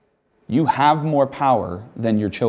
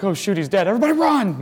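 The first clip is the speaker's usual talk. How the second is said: put-on high, squealing children bolting in panic; panicked kids; 8 semitones higher, faster than usual. Children fleeing a fallen playmate, high panicky squeal. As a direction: yelp it like scared kids scattering. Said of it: "They're like".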